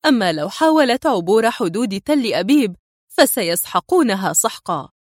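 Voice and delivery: usual speaking voice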